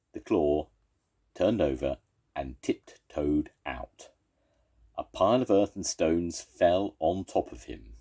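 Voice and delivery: ordinary delivery, nothing marked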